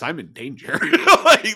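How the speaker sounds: real laugh breaking in, high. Real, not acted. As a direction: laugh through the realization. Laughing at retold realization.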